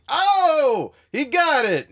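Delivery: loud, high triumphant exclamation; hammed-up cheer for a right answer; Andrew Dice Clay. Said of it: "He goes".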